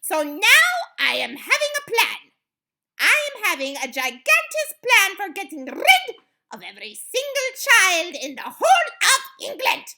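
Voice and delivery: loud, high witch proclamation; barked. A narrator's reading. Grand High Witch announcing her gigantic plan, barked. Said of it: "barked the Grand High Witch".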